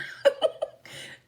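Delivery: breathy burst of laughter; real, voiceless giggle. Real, not acted. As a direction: crack up mid-story. Cracking up at own story.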